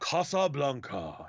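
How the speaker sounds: Casablanca drawn out slowly with broad ah vowels; slow careful Casablanca. Shown as a demonstration. Wondering how the film says it: slow, careful Casablanca. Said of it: "I'm just curious how they pronounce it".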